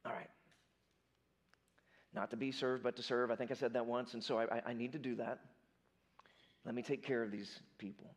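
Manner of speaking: Jesus to himself, worn out but resolved; tired, exhausted. Acted. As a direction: let it out like a drained man giving in. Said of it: "he's tired, he's exhausted".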